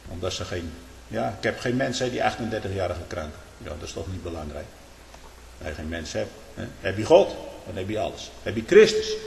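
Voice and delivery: ordinary talk, nothing marked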